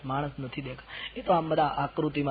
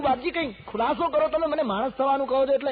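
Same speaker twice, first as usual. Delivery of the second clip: high, loud begging merchant; pleading merchant; 10 semitones higher, 3 dB louder than usual. Acted. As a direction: chase after him begging. Merchant runs after again.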